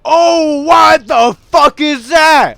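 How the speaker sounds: high, loud, drawn-out shocked yell; slow, stretched scream of shock; startled shout. Real, not acted. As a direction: shout in real alarm at what's on screen. Sudden startle in the game.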